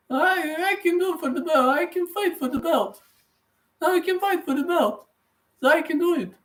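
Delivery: very high squeaky put-on voice; Leon Edwards impression. Streamer attempting a British fighter's title talk, high-pitched impression. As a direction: mimic him far up in a squeal. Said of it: "I don't have British accent, but".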